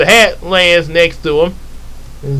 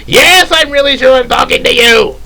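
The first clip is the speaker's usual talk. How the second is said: high, louder exasperated answer; 9 semitones higher, 4 dB louder than usual. Acted. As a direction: insist with comic impatience. Exasperated reply.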